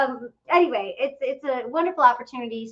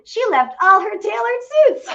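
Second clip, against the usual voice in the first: gleeful; 10 semitones higher, 7 dB louder than usual. Real, not acted. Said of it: "gleeful payoff".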